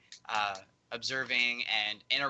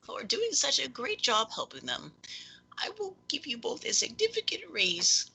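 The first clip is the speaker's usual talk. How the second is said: high, airy character voice; gracious patron, pitched far up; grateful patron. Acted. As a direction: thank them in a high, whispery voice. Game master voices grateful patron.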